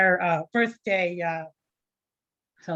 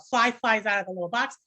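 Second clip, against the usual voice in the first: ordinary talk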